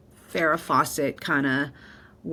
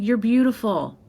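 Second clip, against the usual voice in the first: tender reassurance; 6 semitones higher, 4 dB louder than usual. Retold or imitated. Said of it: "She's like".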